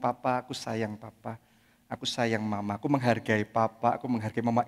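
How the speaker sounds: soft, low loving words; quiet loving words to mum and dad; tender. Shown as a demonstration. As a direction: say it gently from the heart. Modelling loving words to parents.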